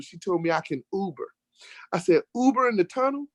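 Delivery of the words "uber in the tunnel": incredulous, pitched much higher, pushing back hard; disbelieving every word she says; exasperated retort. Retold or imitated. Arguing with the tow truck lady over an Uber, higher exasperated retort.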